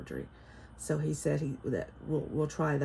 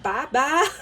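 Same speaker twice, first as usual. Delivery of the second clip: high, loud Southern drawl; Southern grandma accent; 9 semitones higher, 8 dB louder than usual. Retold or imitated. Grandma imitating granddaughter's bye-bye, playful Southern accent. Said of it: "sounds a little bit like a Southern accent".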